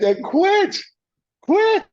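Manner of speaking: a pleading yelp of quit, quit; exasperated urging. Retold or imitated. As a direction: push him hard to just quit.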